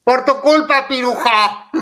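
high, loud mock jeer; teasing yelled blame; blaming shout. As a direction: heckle the handler. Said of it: "los que les gritamos".

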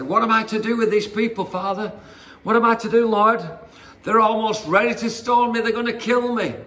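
high-pitched, acted exasperated appeal; desperate plea. Moses pleads desperately with God.

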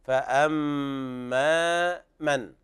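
فأما with the doubled meem held nasally, very slow; ghunna on meem. Shown as a demonstration. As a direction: stretch the mm of فأما in a long nasal hum. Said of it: "والميم حرف غنة مشدد". (غنة الميم المشددة في فأما)

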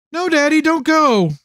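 put-on high, small child's voice, just woken from a nightmare; a frightened young daughter telling her dad about the dream; scared little girl. A narrator's reading. Little daughter Emily to her father, scared child voice.

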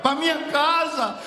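loud, fervent testimony with sweeping pitch; impassioned preaching. Acted. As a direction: proclaim a dying believer's hope at full force. Preaching climax in the woman's words.

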